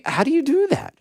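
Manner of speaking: pitched high in disbelief; high, baffled outrage; incredulous. Real, not acted. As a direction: ask it like you can't believe it. Exasperated rhetorical repeat.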